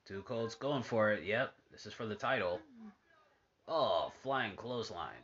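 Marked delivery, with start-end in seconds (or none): 3.7-5.1 casual ringside play-by-play, hushed between moves; wrestling commentator. Acted. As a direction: call each move as it lands. Calling the match on TV.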